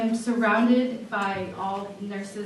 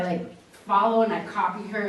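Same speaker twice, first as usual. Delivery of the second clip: ordinary talk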